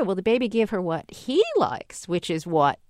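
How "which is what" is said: normal talking voice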